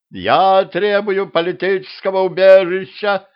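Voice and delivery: high, loud, howling plea; wailing loudly. Acted. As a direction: bawl it out desperately. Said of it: "заголосил Хмырь".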